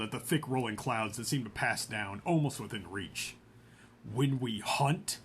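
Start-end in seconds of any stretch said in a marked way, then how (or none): 4.1-5.2 very slow and reluctant; slowly. Acted. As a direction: drag the words out unwillingly. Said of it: "he spoke slowly".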